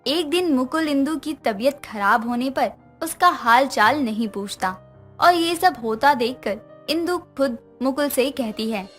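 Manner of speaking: ordinary delivery, nothing marked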